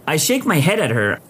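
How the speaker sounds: ordinary delivery, nothing marked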